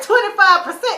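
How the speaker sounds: high, loud incredulous question; can't believe the rate; shocked disbelief. Retold or imitated. Echoing Benny, stunned at the interest. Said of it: "And Benny like".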